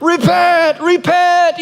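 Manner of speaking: high, hollow call from down a well; muffled prophet's cry from the well; voice from the ground. Retold or imitated. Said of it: "you hear this voice coming out of the ground".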